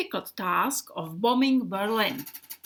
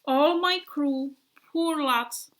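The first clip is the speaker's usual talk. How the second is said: usual speaking voice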